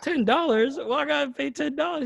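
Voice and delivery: high-pitched retold disbelief; imitated outraged customer, high voice; mock incredulous. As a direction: balk at the price, pitched right up. American customer balking at the price.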